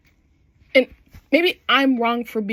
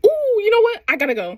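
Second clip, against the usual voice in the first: high-pitched, louder 'ooh' dropping back down; mock-awkward, suddenly high, hammed-up; awkward excuse; 10 semitones higher, 4 dB louder, faster than usual. Character bailing after intimacy, awkward excuse.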